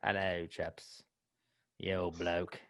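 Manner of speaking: a bad British bloke impression; mock British. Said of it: "Robert used to not have an accent".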